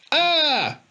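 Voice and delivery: very high frightened yelp; shocked scream. A narrator's reading. Boy frightened by the tramp, very high yelp. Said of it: "cried Harry".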